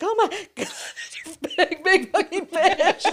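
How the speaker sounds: loud, laughing freak-out; laughing mock panic. Telling friends about the giant fish, laughing scared cry. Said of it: "And I was so scared".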